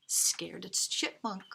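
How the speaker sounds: ordinary delivery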